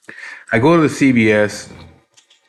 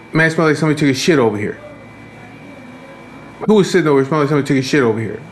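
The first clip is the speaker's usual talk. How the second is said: higher, quick imitation of her griping; mock complaining woman; 5 semitones higher, much faster than usual. Woman in the pharmacy chair griping at everyone, mock complaint. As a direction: mimic an indignant customer complaining aloud.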